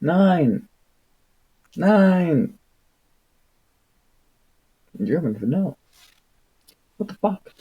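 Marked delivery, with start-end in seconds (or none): none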